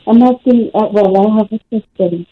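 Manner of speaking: distant speakerphone voice; phone held away from the mouth. Real, not acted. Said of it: "You're on speaker".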